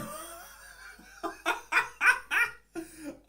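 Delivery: breathless high wheeze of laughter; wheezing laugh. Real, not acted. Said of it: "I'm laughing because".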